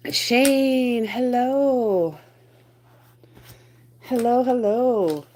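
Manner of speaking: a hint of a cartoonish greeter; lightly voiced character hello; sing-song greeting. Greeting viewer in put-on voice.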